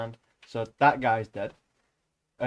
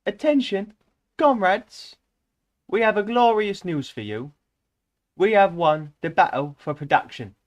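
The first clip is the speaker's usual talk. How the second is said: put-on high, loud proclamation in a young voice; excited young herald with glorious news; eager youthful announcer; 8 semitones higher, 6 dB louder than usual. A narrator's reading. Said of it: "cried an eager, youthful voice".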